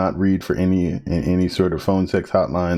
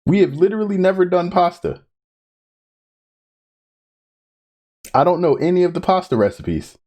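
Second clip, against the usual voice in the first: dismayed; 9 semitones higher than usual. Real, not acted. Dreading a new hard challenge.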